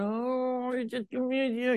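high sputtering mock exasperation; inner fuming. Preacher retelling his inner rage at passengers, high sputter. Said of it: "in my heart, I'm going".

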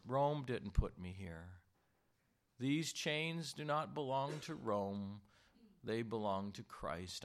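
usual speaking voice

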